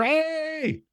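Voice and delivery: high, drawn-out "hey"; excited cheer. Acted. Fans spotting themselves on the big scoreboard, excited cheer. Acting fans seen on kiss cam.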